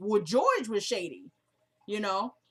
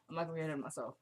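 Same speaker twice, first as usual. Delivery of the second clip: softer self-reminder, not pushed on the not; quiet repeat; 5 semitones lower, 11 dB quieter, much faster than usual. Shown as a demonstration. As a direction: say it again much softer, to yourself.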